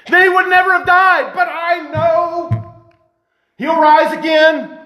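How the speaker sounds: high, slow, stretched-out proclamation; fervent declaration of faith. Acted. Martha answers Jesus in faith, fervent and drawn out. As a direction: cry it out with ringing conviction.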